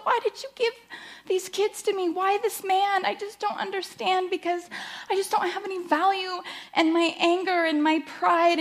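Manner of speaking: high, pleading prayer voice, close to tears; despairing prayer. Retold or imitated. Wife retelling her prayer of despair to God, high and pleading. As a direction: cry out to God that you have no worth.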